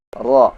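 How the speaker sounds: "Ra" with a light trill; slightly rolled ra. Shown as a demonstration. Teacher demonstrating "Ra" with a small trill. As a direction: trill the r of "Ra" a little. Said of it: "Ada sedikit getarannya".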